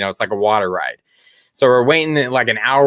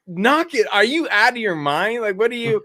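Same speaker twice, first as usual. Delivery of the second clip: high-pitched retold parental disbelief; exasperated scolding; 11 semitones higher than usual. Dad stopping his stupid kids, exasperated scolding. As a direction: scold the kids in mock alarm.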